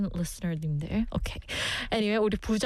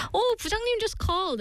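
mock fake excuse; 12 semitones higher, much faster than usual. Acted phone excuse.

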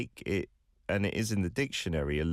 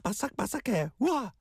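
echoing surprise; much faster than usual. Acted. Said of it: "teacher echoes surprise".

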